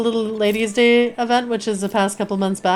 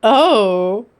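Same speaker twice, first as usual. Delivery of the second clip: normal talking voice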